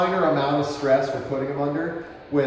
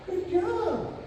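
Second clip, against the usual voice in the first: high praise; 5 dB quieter than usual. Real, not acted.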